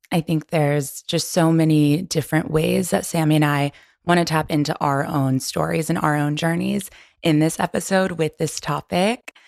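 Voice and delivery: normal talking voice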